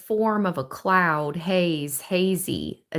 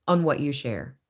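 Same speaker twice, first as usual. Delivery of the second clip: normal talking voice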